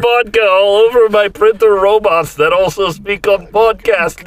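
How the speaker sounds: hammed-up Russian bot maker confessing; mock Russian accent. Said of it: "Mother Russia".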